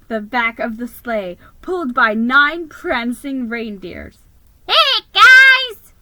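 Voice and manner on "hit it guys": high, loud elf shout. Acted. Elf shouting to the reindeer to take off. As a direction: call out to the reindeer. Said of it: "Ding Dong shouted".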